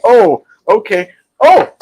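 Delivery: high, slow mock-surprised oh; a hammed-up oh of realization; mock surprise. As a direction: act caught off guard, drawn out. Retelling catching the scammer.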